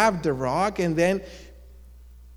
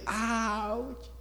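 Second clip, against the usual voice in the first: higher, drawn-out "ouch"; mock pained yell; 7 semitones higher, 6 dB quieter than usual. Rock crashing against another rock, mock pained yell. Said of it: "the rock will yell".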